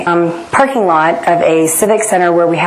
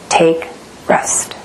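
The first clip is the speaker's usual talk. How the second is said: hushed Jesus voice; 3 semitones lower than usual. Retold or imitated. Jesus speaks to her in story.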